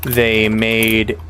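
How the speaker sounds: very slow, drawn-out narration; slow story reading. A narrator's reading. Streamer reading the game's text, very slow. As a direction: read each phrase slowly, with pauses. Reads game narration slowly.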